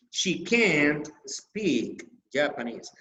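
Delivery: slow, with "can't" stretched and stressed. Shown as a demonstration. Teacher repeats the negative example, main stress on can't. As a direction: lean hard on "can't". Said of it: "the main stress is in can't".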